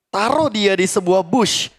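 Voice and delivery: ordinary delivery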